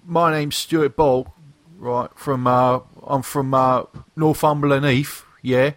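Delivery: hammed-up British geezer greeting; fake British accent. Podcast host introducing the lads, in a fake British accent. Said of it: "enough of the fake British accents".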